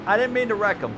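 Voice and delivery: high, loud horrified apology; guilty outburst. Real, not acted. Racer after wrecking a rival, loud shocked apology. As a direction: blurt it out, horrified at what you did.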